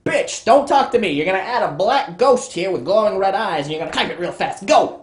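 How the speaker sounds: loud, high, hammed-up tirade; bullying boss. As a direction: shout down the underling and order him to work. Boss berates the writer.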